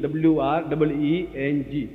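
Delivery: letters of रिंग spelled slowly in English. Shown as a demonstration. Teacher spelling the bija रिंग in English letters. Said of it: "ये रिंग".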